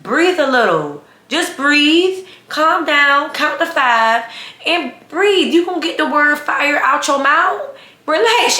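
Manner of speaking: high-pitched, emphatic mock coaching; exaggerated calm-down retort. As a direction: tell an overexcited coworker to settle, hammed up. Acted retort to the frantic coworker.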